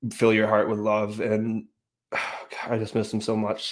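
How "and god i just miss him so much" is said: real grief, softer, with a heavy sigh; missing a lost friend, quietly choked up; emotional. Real, not acted. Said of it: "I had to get emotional".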